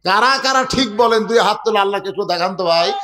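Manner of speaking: normal talking voice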